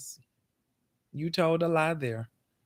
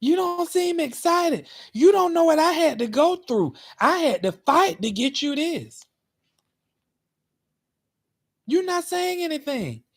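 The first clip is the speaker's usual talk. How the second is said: much higher pleading boss voice; imitating manager's high voice; 10 semitones higher, 4 dB louder than usual. Mocking his manager urging him to get excited, very high voice. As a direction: squeak it out like the pushy manager. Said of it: "She said".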